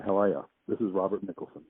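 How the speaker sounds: normal talking voice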